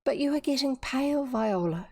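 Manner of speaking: mildly tentative concern. A narrator's reading. Dorothy worried for pale Viola, tentative. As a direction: gently venture it, pitch lifted at first. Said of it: "she ventured".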